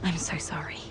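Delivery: soft whispered apology; whispering. Acted. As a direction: breathe it, barely voiced. Apology after sharing her troubles.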